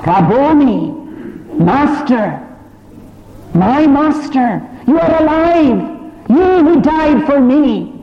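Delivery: Mary crying out in joy to the risen Jesus; joyful recognition. Acted. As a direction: let the joy burst out.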